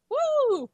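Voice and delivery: high squeaky cork-pop woo; champagne cork pop. Pretend. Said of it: "I'm gonna do it again".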